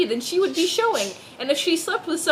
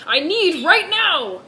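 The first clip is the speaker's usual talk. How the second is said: loud, slow shout at the nurse; yelled line; 5 semitones higher, 6 dB louder, slower than usual. Acted. Brother yelling at the front desk, loud and slow. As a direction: yell it out for the nurse. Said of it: "yelled at the nurse".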